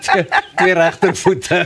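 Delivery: slow, laughing through words. Real, not acted. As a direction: chuckle while saying it. Laughing at shoe joke.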